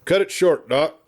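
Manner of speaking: a friendly mock-impatient cry; good-natured cry. Marshal good-naturedly cutting off the wordy doctor.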